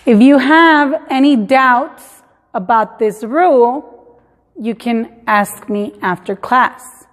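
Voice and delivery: careful, slow English read; teacher demo; slow English example. Shown as a demonstration. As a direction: read slowly to the class. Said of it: "Si tienen dudas".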